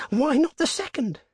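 very high, urgent question; feverish. A narrator's reading. Old woman arguing for another wish, feverishly. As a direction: demand it feverishly, pitched high. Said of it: "said the old woman feverishly".

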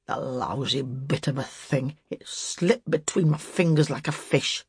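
put-on slurred, slobbery old man; low start, sloppy articulation; loose, slavering speech. A narrator's reading. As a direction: let the words slide out loosely, slavering. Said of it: "articulating his words loosely and slavering in his speech".

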